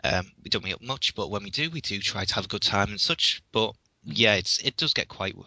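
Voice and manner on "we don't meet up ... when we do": ordinary delivery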